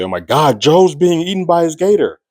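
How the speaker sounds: high alarmed shout of discovery; officer shouting in shock at the gator attack; shocked cry. Retold or imitated. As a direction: cry out in horror at the scene. Police officers discover Joe.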